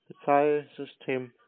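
ordinary delivery

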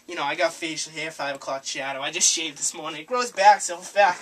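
ordinary talk

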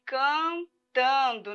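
cantando said very slowly; slow, drawn out. Shown as a demonstration. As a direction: stretch cantando syllable by syllable. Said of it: "Olha só a palavrinha".

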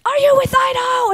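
high, urgent voice of a film assistant; hurried crew woman. Retold or imitated. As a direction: rush the kid inside in a high voice. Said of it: "and she said".

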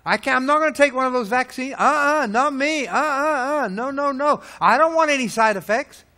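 hammed-up frightened no-no-no; mock fearful believer. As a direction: play the scared churchgoer refusing the shot. Mocking fearful churchgoer.

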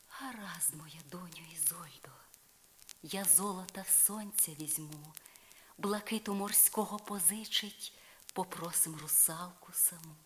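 fairy Morgana murmuring to Isolde; gentle fairy godmother. Acted. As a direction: soothe your goddaughter softly. Morgana answers.

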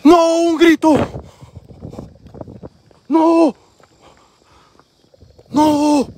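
high, loud cries of fright; alarmed cry. Real, not acted. Ghost hunter reacting to a scream heard nearby, frightened cry.

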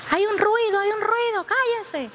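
high-pitched, insistent calls for silence; acted urgent hushing. Passenger silencing the others on hearing a motor, urgent cry.

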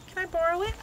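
high, lightly voiced child; daughter begging. Daughter asking mommy to borrow the gift, pleading. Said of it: "She's gonna be like".

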